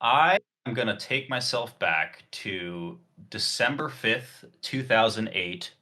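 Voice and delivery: slow, soft, low recital; mock-solemn storytelling. Player takes himself back to two thousand eight, mock-solemn. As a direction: recite it gravely, with long pauses. Announces time travel as solemn tale.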